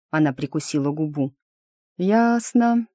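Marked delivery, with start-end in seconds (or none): 2.0-2.9 high, drawn-out word in a yawn; through a yawn. A narrator's reading. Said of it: "Моя реплика была смазана зевком".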